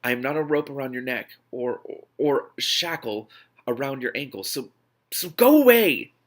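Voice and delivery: restrained anger that snaps at the end; rising anger. Acted. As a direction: hold it in, then let go away burst out. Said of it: "I said angrily".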